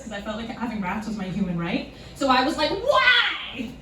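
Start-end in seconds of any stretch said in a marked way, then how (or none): 2.7-3.7 high, wailed question; hammed-up dismay, pitched way up; mock-hurt protest. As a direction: plead it in a high, wounded voice. Acted hurt reply to refusal.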